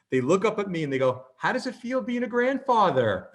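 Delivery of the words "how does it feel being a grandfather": high-pitched imitation of a cooing stranger; mock-friendly question, pitched way up; gushing older stranger. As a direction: pipe up sweetly as a clueless admirer. Older couple mistakes him for grandfather.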